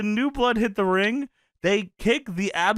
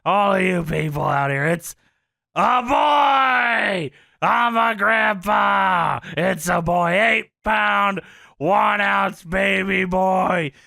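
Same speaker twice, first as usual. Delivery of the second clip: Funk proud announcement; 2 semitones higher, 4 dB louder, slower than usual. Retold or imitated. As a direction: belt it out like a proud grandpa. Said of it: "I'll tell you the announcement".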